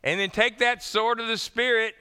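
high, loud exhortation from the pulpit; fervent preaching. Real, not acted. Pastor urging the congregation to take up scripture, fervent loud preaching. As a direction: preach it out with full force.

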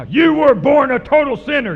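loud, angry accusing shout; mad. Acted. Angry leaders condemn the healed man, acted mad shout. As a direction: hurl it as a furious accusation. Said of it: "Ooh, they're mad now".

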